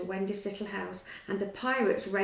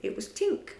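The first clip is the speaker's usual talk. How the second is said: ordinary delivery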